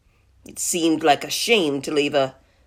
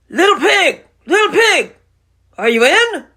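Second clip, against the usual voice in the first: high, loud singsong call; 10 semitones higher, 6 dB louder than usual. Acted. Wolf calling into the pig's house, loud high call. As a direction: holler for a neighbor who may be home. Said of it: "so I called".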